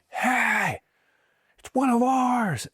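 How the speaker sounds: high, strained shout across the scene; yelling. Retold or imitated. Agent yelling to firefighters that it's one of ours, high shout. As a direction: call out sharp to the arriving crew. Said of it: "And I remember yelling at them".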